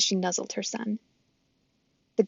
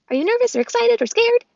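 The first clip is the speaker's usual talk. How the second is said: squirrel voice; 7 dB louder than usual. Acted. Chit Chat the squirrel.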